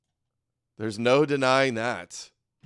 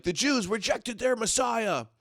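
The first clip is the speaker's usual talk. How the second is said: higher, put-on pious whine; 9 semitones higher, 5 dB quieter than usual. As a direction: parody a self-righteous believer, pitched up. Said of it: "Christians are like".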